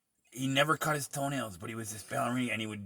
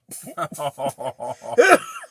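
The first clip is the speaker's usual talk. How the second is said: loud high laugh; 11 semitones higher, 11 dB louder than usual. Real, not acted. Laughing at own joke.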